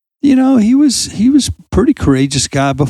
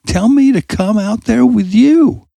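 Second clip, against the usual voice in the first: retold yell; 8 semitones higher, slower than usual. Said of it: "he starts yelling out".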